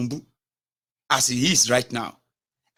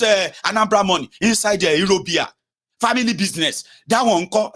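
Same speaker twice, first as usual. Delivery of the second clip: higher and louder, a heated mock comeback; mock supporter retort; 5 semitones higher, 5 dB louder than usual. Vlogger imitating a supporter's loud dismissive retort. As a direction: shout down the critic as a defensive fan.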